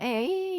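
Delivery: put-on high thin creak like an old weather vane; creaking weather vane sound. Shown as a demonstration. Teacher imitating a weather vane waking up, high creak. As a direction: let out a rusty high creak. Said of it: "그 내는 그런 소리 있잖아요".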